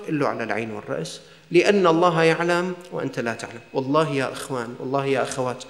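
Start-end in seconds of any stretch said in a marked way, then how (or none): 0.3-1.2 soft humble reply. Acted. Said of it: "humble reply voiced".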